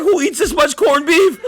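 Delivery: high-pitched, laughing through the quote; cracking up at a friend's meat haul. Real, not acted. Telling a buddy about six huge corned beefs, laughing.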